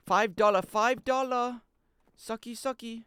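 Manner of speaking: high, mock Vietnamese accent. Streamer imitating a Full Metal Jacket character, high Vietnamese accent. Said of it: "That's why I could do the accent".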